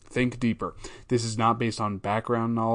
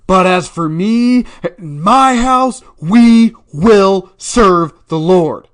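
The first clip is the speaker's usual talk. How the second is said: much higher, much louder, slow proclamation; proclaiming shout; 9 semitones higher, 16 dB louder, slower than usual. Shown as a demonstration. Host demonstrating the verse as a loud, slow preacher's shout. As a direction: thunder it like a preacher from the pulpit. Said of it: "There's multiple ways to read it".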